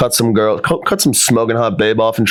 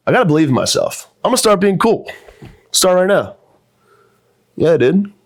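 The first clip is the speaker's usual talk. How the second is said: higher, cocky cool-guy voice; ignorant cool guy; 6 semitones higher than usual. Acted. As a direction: brag like a dumb cool guy.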